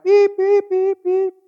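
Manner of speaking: high, squeaky bleep noises in place of swearing; censor bleep. Pretend.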